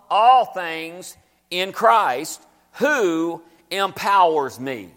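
drawn-out "All things" with weight; slow emphatic reading. Acted. Preacher stressing the verse to the church. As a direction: stretch it out, lean on all.

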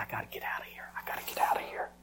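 hushed, panicked whisper; low, secretive urgency; urgent whisper. Retold or imitated. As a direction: whisper a desperate need to escape. Retold panic in the restaurant.

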